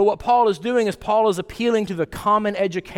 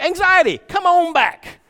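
much higher and louder, sarcastic cheery welcome; preacher mocking a mind that invites anxiety back, sarcastic welcome; 11 semitones higher, 5 dB louder than usual. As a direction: throw the gates open like greeting an old friend, in mockery.

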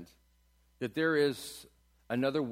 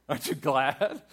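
ordinary talk, nothing marked

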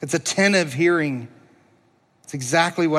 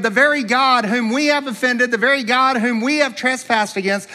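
ordinary talk

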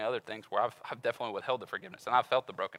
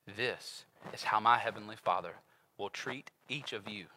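slow, soft, grave reading; solemn scripture reading; 4 semitones lower, 5 dB quieter, slower than usual. Real, not acted. Preacher reads Jesus' warning slowly and softly. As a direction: weigh every word.

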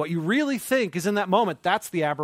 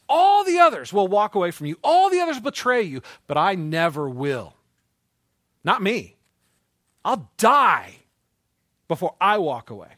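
boastful vow; 3 semitones higher, 8 dB louder than usual. Retold or imitated. Peter boasting to Jesus.

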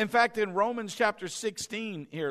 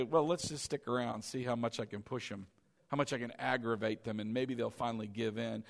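scheming devil; 7 semitones lower, 6 dB quieter, faster than usual. Retold or imitated. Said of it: "He's just gonna sit there and say".

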